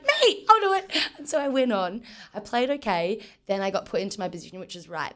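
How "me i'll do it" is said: high, bright, keen "me, I'll do it"; eager volunteer. Retold or imitated. Player volunteering to the coach for midfield, eager and high. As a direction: jump at the chance, much higher and louder.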